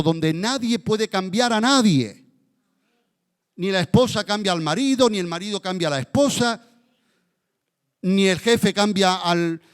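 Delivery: high, fervent pulpit cry building through the list; impassioned preaching. Acted. Preacher crying out to God over hardened hearts, fervent. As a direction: preach it out, pleading and urgent. Preacher rising to full intensity.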